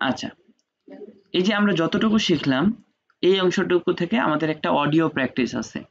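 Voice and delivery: usual speaking voice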